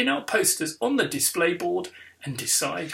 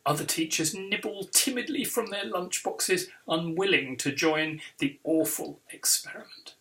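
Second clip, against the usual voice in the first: ordinary delivery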